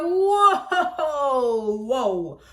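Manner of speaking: slow incredulous laugh; startled whoa. Retold or imitated. Answering her boss's accusation, laughing disbelief. Boss blames her for lateness.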